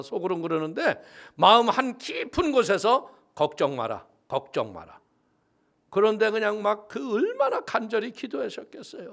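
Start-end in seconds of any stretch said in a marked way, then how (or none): 3.3-5.0 calm inner voice. Retold or imitated. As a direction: sink low and hush like a voice from deep inside. Inner voice from deep within.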